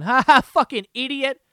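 loud jeering laugh at an idiot; mocking laugh. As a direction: jeer like a heckler. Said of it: "love going".

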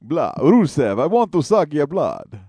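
mock vampire with a Transylvanian accent; Dracula. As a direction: do a hammy vampire line. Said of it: "you should be Dracula".

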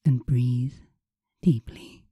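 slow, soothing hypnotist voice. Acted. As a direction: guide them slowly into calm. Leads hypnosis session.

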